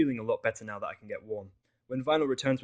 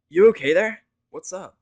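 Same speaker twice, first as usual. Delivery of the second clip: higher, much louder concerned mare; Vinyl's voice; 9 semitones higher, 17 dB louder than usual. Acted. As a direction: snap him out of his daze.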